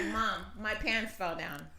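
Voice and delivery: put-on slow flat kid voice; calm, no alarm at all; lightly voiced deadpan son; flat deadpan child. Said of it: "That's exactly what he was like".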